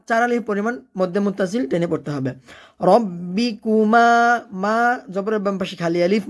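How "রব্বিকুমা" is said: "রব্বিকুমা" chanted slowly, final "মা" held; one-alif elongation. Shown as a demonstration. Teacher showing learners "রব্বিকুমা" with a held ending. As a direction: hold the last vowel of "রব্বিকুমা". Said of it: "এক আলিফ পরিমাণ টেনে পড়তে হবে".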